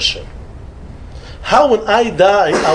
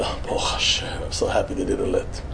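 soft, breathy whisper of relief, much softer; relieved whisper; 13 semitones lower, 12 dB quieter than usual. Retold or imitated. Rabbi retelling his relief to the man on the plane, whispered. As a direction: whisper it as if thanking God under your breath.

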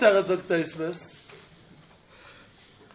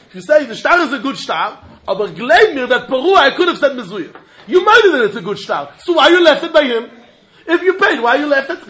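challenging; 4 semitones higher, 7 dB louder than usual. Acted. Challenging the borrower.

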